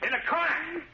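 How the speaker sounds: high, wild voice, unhinged; crazed. Acted. Danny threatening the Shadow with a grenade, high and crazed. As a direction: sound like he's lost his mind.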